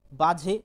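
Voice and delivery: বাজে said loud with a rising end; teacher stressing বাজে to let students hear it; emphasised word. Shown as a demonstration. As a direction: lean hard on বাজে. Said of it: "যদি বাজে বলি".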